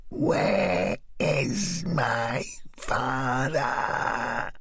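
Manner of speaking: very slow, possessed and crying; as if something inside her speaks; very slow, eerie possessed voice; gruff, deep voice. Acted. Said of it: "Her voice had gone gruff, deep".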